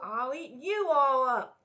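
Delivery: high, loud slow threat; fierce threat. Acted. Parent echoes Dot's threat.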